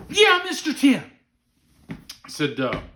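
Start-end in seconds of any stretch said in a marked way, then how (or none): 0.1-1.0 high, loud mock child's reply; eager little boy. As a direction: pipe up like an excited kid. Retold child eagerly answering guide.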